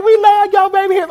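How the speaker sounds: screaming fan. Retold or imitated. Fan yelling a compliment to singer signing autographs, shrieked adoration.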